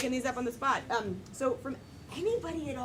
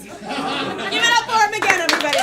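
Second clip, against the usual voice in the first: high, loud hype to the crowd; big emcee holler; host's rallying shout; 7 semitones higher, 13 dB louder than usual. Real, not acted. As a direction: shout for applause, stretching the last word. Host rallies audience applause.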